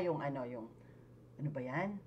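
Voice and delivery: hesitant, groping for the word; demonstrating fillers. Shown as a demonstration. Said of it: "yung mga ganon". (nag-aalangan, nangangapa ng sasabihin)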